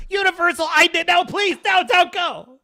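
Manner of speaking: high, loud hammed-up begging not to go; mock pleading. Begging Universal not to unsubscribe, in loud mock desperation. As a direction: beg him to stay, over the top.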